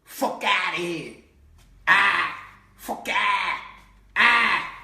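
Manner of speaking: belted New York street talk; New York accent. Retold or imitated. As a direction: bark it out with a broad New York "ah". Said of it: "New York people talk like that".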